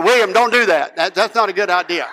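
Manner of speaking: loud, urgent coach's warning; alarmed warning. Retold or imitated. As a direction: call out to stop the batter. Coach warns boy at plate.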